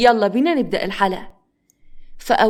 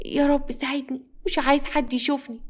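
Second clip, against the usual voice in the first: put-on higher, hushed boy talking to himself; scared child; 5 semitones higher, 4 dB quieter than usual. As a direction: pray softly like a frightened little boy. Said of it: "عمر بخوف كان بيكلم نفسه".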